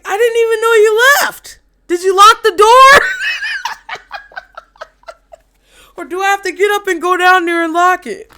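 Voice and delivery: high, loud whining shout; mock whiny yell. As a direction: holler after them, half laughing. Pisces yelling after them.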